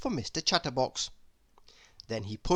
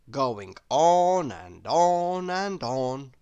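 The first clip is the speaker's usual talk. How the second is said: slow, stretched and louder, each "on" dragged; drawn-out; 4 dB louder, much slower than usual. A narrator's reading. Narrator drawing out Mister Chatterbox's endless talk, slow and stretched. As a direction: drag each "and on" out longer and longer.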